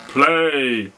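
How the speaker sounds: one loud barked command; giant's roar. Acted. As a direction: roar it at the harp. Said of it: "roared the giant".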